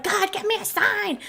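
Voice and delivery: high pitched plea to God; a mock desperate prayer; mock pleading. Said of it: "you're like".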